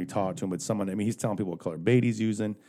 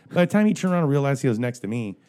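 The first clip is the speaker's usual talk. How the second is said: normal talking voice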